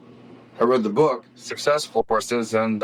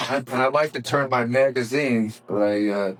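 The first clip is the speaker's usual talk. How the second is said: ordinary talk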